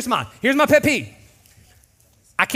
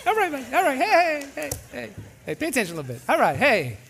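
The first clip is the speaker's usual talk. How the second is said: friendly horn voice; 7 semitones higher than usual. Shown as a demonstration. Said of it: "beep is like".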